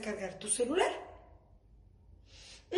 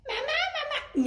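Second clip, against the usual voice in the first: put-on child's call; slower than usual. Child calling mom.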